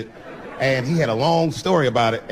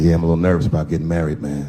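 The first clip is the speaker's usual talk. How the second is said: low-pitched, quick confiding voice; friend's voice; 10 semitones lower than usual. Acted. Nervous groom confides in married friend, low-pitched. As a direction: drop the pitch to play the friend.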